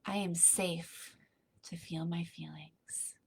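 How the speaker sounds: soft, calm breathing guidance that fades toward a whisper; guided meditation. Acted.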